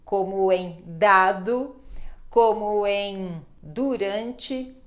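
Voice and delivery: dado and durante said slowly with a plain D; slow D demo. Shown as a demonstration. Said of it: "Nós vamos fazer dá, do e du". (som de D, bem lento)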